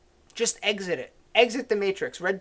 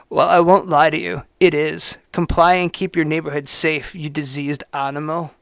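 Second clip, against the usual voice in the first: mock hater's voice; 4 semitones lower, 4 dB louder than usual. Host reading a hater's post aloud, lower and louder in mocking voice. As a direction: sneer it out as the smug writer. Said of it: "Trying to do this in his voice".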